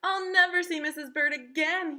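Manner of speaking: high, loud lament; crying out. Acted. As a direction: cry it out in a high voice. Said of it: "he cried".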